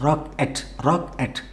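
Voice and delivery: రాక్ ఎట్ softly, in two separate beats; syllable by syllable. Shown as a demonstration. Teacher breaking the word for learners, syllable by syllable. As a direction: say రాక్, brief gap, then ఎట్. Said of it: "రాకెట్ కాదు".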